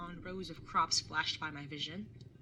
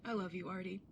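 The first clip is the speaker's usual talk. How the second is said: softer, tender I love you; 5 dB quieter than usual. Acted. Brother telling his sister he loves her, soft. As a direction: say it softly. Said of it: "Never say it to me all soft like that".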